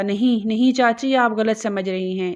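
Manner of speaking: ordinary delivery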